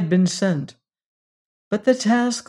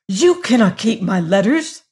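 angry demand; 4 semitones higher, 6 dB louder than usual. A narrator's reading. Angry servant demanding letters.

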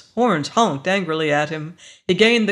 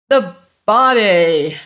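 loud, drawn-out shout; desperate, insistent yell; 2 semitones higher, 9 dB louder, slower than usual. A narrator's reading. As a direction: shout it slowly so everyone hears. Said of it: "Ed shouted".

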